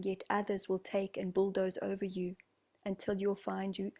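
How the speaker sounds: ordinary talk, nothing marked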